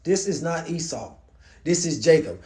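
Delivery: hushed, doubting father; quiet, dawning suspicion in an imagined voice; acted suspicion. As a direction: drop soft as you work out who it is. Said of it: "he gonna be like".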